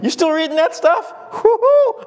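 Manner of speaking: high-pitched mock astonishment; playful disbelief breaking into a whoop; surprised. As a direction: marvel in a high voice, then whoop. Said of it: "He'd probably be surprised".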